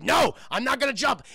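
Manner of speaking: loud, high defiant cry; acted frantic protest; screaming refusal. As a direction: shout back at the voices. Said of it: "he's just screaming".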